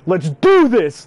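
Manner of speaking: loud, drawn-out rallying yell; hyped-up mock scream; retold battle cry. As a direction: belt it out like charging into a fight. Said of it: "I scream".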